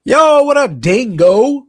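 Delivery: loud, high-pitched call-out; shouted greeting. Real, not acted. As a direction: holler a big hello to a buddy. Calling out to greet Dingo.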